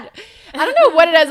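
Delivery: high, loud, fast protest; exasperated outburst. Retold or imitated. Daughter arguing with disbelieving dad, exasperated outburst. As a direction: raise your voice, retelling an argument.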